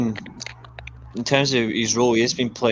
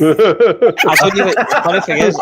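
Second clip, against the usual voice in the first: amused, higher and louder through laughter; laughing; 6 semitones higher, 6 dB louder than usual. Real, not acted. Teased friend answers banter about a football shirt, laughing. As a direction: laugh while starting to explain.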